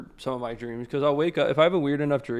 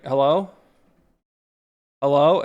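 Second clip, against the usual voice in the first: louder rising "Hello?" into a dead phone line; calling out hello; 2 semitones higher, 10 dB louder than usual. Retold or imitated. Retelling a dream to chat, calling a silent 911 line, loud. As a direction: call "Hello?" louder each time, rising.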